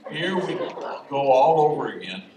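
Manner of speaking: weary here-we-go-again; exasperated. Retold or imitated. Priest sighing at returning homie, exasperated. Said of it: "I'm a little exasperated".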